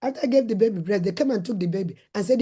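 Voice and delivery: ordinary delivery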